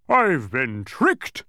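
loud, slow, dismayed outcry; helpless cry. A narrator's reading. Mathematician realizing Milo has outwitted him, helpless cry. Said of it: "cried the mathematician helplessly".

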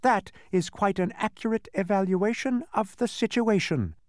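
high, cool character voice; cold. Acted. Unseen voice to its captives, coldly high-pitched. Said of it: "said the voice coldly".

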